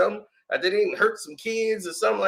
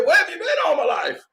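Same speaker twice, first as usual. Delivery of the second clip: mock thrilled coach; 7 semitones higher than usual. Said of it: "The coach signed him ... and said".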